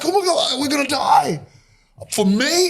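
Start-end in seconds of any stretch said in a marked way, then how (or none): 0.0-1.4 mock panic. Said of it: "They were like".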